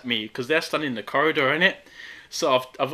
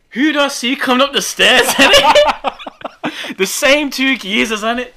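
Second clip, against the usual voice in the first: laughing; 9 semitones higher, 10 dB louder, slower than usual. Real, not acted. Laughing at the twist.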